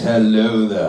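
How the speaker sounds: low, loud-ish put-on male puppet; ventriloquist's deep man voice; man's dummy voice. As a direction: drop deep for the husband dummy. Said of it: "What about a voice like this?".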